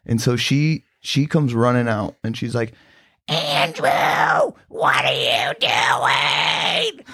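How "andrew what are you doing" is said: smoker mom yelling. Retold or imitated. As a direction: imitate a mom hollering for her kid, stretching every word. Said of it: "like, a smoker's voice".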